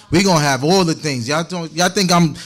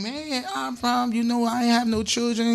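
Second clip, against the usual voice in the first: lightly voiced wife, higher and much softer; a coaxing wife, pitched up and hushed; Sarai voiced; 7 semitones higher, 8 dB quieter than usual. Pastor voices Sarai to Abram.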